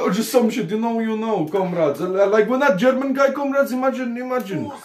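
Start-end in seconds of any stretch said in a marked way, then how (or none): none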